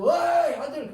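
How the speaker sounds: loud high hail, easing off at the end; acted parent's shout; calling one's son. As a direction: holler for your son. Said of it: "자기 아들도".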